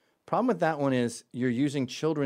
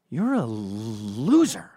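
slow, loud, drawn-out insult; mock scorn, slowed right down; mock put-down; 8 dB louder, much slower than usual. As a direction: lean on each word like a verdict. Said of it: "And you go".

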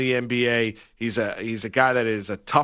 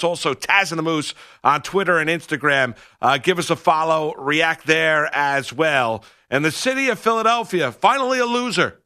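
punchy radio announcer pitch, higher and louder; radio promo read; 5 semitones higher, 3 dB louder than usual. Acted. Host urging radio listeners to call in, upbeat announcer read. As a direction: sell the call-in line to the audience, bright and driving.

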